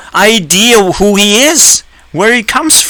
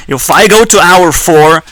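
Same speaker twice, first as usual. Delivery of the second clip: ordinary talk, nothing marked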